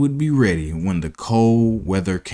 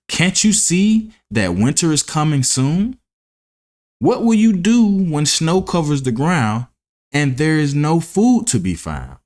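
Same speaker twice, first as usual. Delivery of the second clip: lightly voiced earnest ant, higher; warning ant; 6 semitones higher than usual. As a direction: press the warning home.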